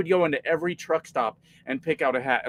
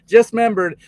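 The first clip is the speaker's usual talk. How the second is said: higher and louder, stressing the sudden realization; a sudden louder second take; louder emphatic repeat; 6 semitones higher, 9 dB louder than usual. Real, not acted. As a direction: blurt it out again, louder and higher.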